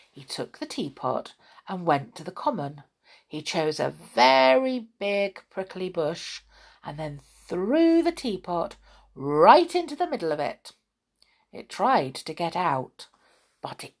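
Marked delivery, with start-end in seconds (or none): none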